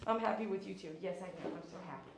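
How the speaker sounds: soft, tender cooing to the dog; soft pet talk. Real, not acted. Groomer praising the happy dog, soft and tender. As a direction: murmur kindly to a wagging pup.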